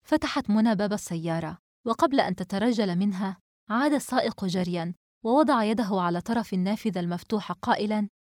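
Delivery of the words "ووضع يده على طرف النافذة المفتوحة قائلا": plain reading voice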